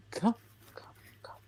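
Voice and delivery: whispering. Pretend. Coaxing muted friend in whisper.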